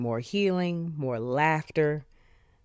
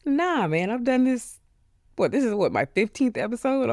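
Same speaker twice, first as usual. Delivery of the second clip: dismissive self-talk; 10 semitones higher, 4 dB louder than usual. Retold or imitated. Dismissing own nerves.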